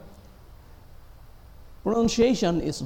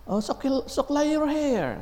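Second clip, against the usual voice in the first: put-on much higher cooing baby talk; 13 semitones higher than usual. Parent telling a baby to comb its hair, high cooing. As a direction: coo it sweetly to a toddler. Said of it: "talk to their babies like".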